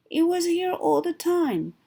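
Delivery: high, startled discovery; surprised. Acted. Toad realising the button was home all along. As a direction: gasp it out in relief.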